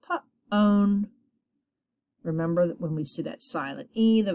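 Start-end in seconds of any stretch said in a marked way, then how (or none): 0.0-1.1 Pa-own split into first sound and long-o ending. Shown as a demonstration. Teacher modelling a silent E word, sounded out. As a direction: say Pa-own in two stretched parts. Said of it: "Hopefully you're saying to yourself".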